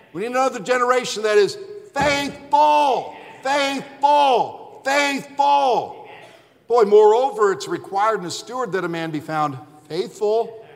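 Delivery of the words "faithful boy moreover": ordinary delivery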